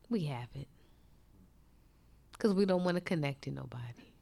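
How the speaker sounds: low, soft, near-whispered sermon; preacher lowering voice to convict the church; hushed preaching. Acted. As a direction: draw them in with a hush.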